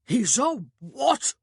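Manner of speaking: slow, high, outraged shout. A narrator's reading. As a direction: shout it in drawn-out disbelief. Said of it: "he shouted".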